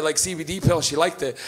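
usual speaking voice

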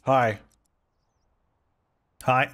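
normal talking voice

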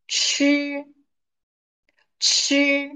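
吃 said as chi with a high level first tone; high flat tone on 吃; 吃 in Mandarin, first tone; Chinese syllable chi. Shown as a demonstration. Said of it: "吃 means to eat".

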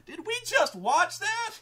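slow, high, loud mock disbelief; horrified crowd. Crowd after watching the killing, horrified disbelief. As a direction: imitate stunned onlookers, stretched and squeaked up. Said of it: "like, horrified".

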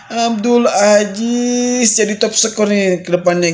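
ordinary delivery

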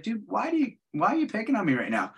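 imitating his own harmless protest; non-threatening. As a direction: ask it mildly, with no threat in the voice. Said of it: "I wasn't even being threatening".

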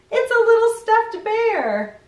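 high, loud "aww" of delight. Real, not acted. Showing the kids a toy bear, high delighted gush. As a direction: gush over the cute toy.

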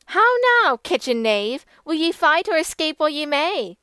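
high, loud jeering challenge; mocking shout; cried out. A narrator's reading. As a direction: taunt the kitchen knave. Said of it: "cried the damsel".

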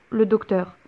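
ordinary delivery, nothing marked

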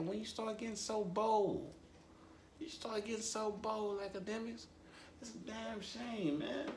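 squeaky high tut-tutting, fading soft; high mock scolding. Real, not acted. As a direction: scold him like a disappointed auntie.

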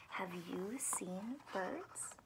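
soft, slow whispered question; whispered refrain. Acted. Reading the refrain to children, slow whisper. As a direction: breathe the refrain out gently.

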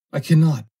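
high, loud pleading cry; scared cry. Acted. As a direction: beg him to stop. Foster begs, repeated.

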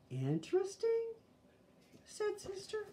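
put-on high, puzzled little girl question. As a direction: ask it baffled, like a small girl. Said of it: "in a puzzled voice".

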